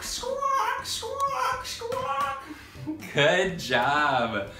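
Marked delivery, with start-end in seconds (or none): 0.0-2.4 very high shrill bird squawk; pterodactyl squawk. Shown as a demonstration. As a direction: squawk up high like a flying reptile. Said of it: "go squawk".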